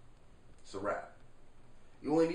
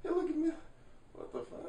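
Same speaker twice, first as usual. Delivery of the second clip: mocking reply; 12 semitones higher, 4 dB quieter, faster than usual. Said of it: "what do you think this person says".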